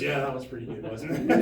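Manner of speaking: lightly mimicked Trump, pitched low; a half-hearted low impression of a pleased president; poor Trump impersonation. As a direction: drop the voice for a self-satisfied quote. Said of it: "I don't do a good Trump impersonation".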